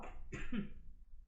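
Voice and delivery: second rough cough. Real, not acted. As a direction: clear the throat after choking. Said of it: "j'ai avalé de travers".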